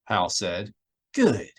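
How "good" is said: bright quick cheerful good; cheerfully approving. A narrator's reading. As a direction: chirp it pleased. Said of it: "Miranda said cheerfully".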